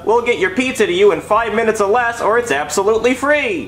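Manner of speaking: commercial voice. Acted. Ad read with shouted slogan.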